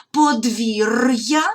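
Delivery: подвір'я said very slowly with a clear break before я; separated pronunciation. Shown as a demonstration. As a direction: stretch подвір'я, keeping р hard and then a separate й-а. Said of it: "роздільна вимова р".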